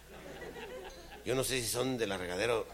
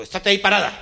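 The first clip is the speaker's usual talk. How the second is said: louder, higher order; loud command; 9 semitones higher, 13 dB louder, faster than usual. Acted. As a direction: order her to stand still. Hombre ordena fuerte. (orden fuerte: quédate ahí)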